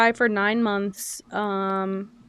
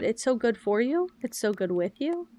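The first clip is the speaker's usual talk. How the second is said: ordinary talk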